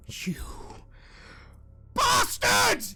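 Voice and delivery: high, raging cry; furious shout. Acted. Father curses the intruder, raging shout. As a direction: scream it as he lunges. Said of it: "he lunges at you with rage".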